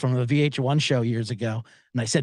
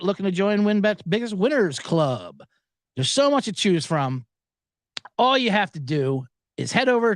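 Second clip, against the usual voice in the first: higher-pitched, steady sponsor read; 6 semitones higher than usual. Acted. Podcast host pitching a betting sponsor to listeners, higher ad-read voice.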